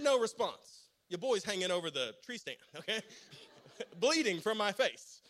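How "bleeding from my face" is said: ordinary delivery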